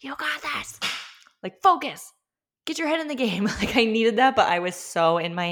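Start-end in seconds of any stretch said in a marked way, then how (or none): 0.0-3.4 high-pitched, urgent pep talk; punchy, high mock motivational orders; intense coaching. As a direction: imitate an intense coach firing someone up. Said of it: "someone intense with direct eye contact".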